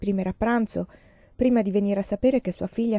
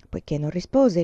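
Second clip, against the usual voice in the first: usual speaking voice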